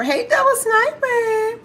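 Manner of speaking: high, drawn-out hello; excited greeting. Real, not acted. Host welcoming a viewer to the live, excited high greeting. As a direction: greet a favorite viewer in a long, high call.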